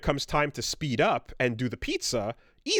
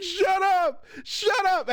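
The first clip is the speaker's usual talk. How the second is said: mock yell back; much slower than usual. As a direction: shout back like an embarrassed kid. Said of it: "And I'm like".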